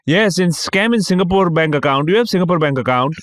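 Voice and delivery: imitated high Indian-accented scammer. Scam caller asks his target about banking, imitated Indian accent. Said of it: "it's a dude with a Indian accent".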